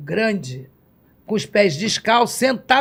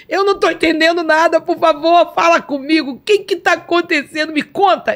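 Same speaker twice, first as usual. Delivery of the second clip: high, loud, urgent begging; desperate pleading; 8 semitones higher, 4 dB louder than usual. Retold or imitated. Kneeling before Jesus and begging him to explain, high and loud. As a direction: beg him to talk to you, voice up high.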